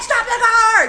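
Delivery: high, loud, excited Stop the car; shouted stop the car. Retold or imitated. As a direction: yell it as if the car must stop now. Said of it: "and I was like".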